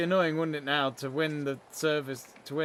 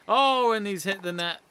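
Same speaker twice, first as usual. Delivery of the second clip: a burst of excitement on the call; loud excited exclamation; 5 semitones higher, 7 dB louder than usual. Real, not acted. Excited live commentary.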